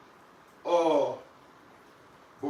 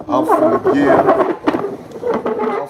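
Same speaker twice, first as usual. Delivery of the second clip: much higher and louder burst; sudden yell; 13 dB louder, slower than usual. Real, not acted. Owner teasing the punished dog, sudden loud high yell. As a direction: blurt it out in a loud squawk.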